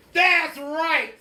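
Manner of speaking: a mean teacher snapping; stern witch teacher. Acted. Strict witch teacher voiced.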